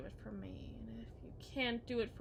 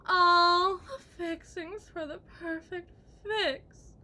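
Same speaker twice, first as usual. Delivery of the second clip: mock tearful taunt; 5 semitones higher, 12 dB louder, slower than usual. Wife taunting, teary.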